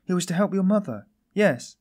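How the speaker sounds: high, loud, urgent outburst; sudden cry. A narrator's reading. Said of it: "but suddenly she cried".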